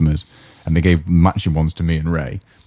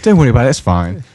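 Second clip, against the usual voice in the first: lightly imitated, higher New Zealand lilt; 6 semitones higher, 4 dB louder than usual. Barmaid covering for the swearing cook, light New Zealand lilt. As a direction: reassure breezily with a hint of New Zealand. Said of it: "in that New Zealand, like, lilt".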